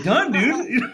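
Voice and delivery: laughing, high and loud. Retold or imitated. Telling his best friend he's in, laughing. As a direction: blurt it out through laughter. Retold eager reply, laughing.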